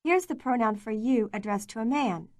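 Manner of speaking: ordinary talk, nothing marked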